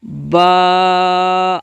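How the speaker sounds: با with a long stretched vowel; drawn-out ba. Shown as a demonstration. Teacher shows pupils the long vowel, stretched با. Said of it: "با کو کھینچ کر پڑھیں گے".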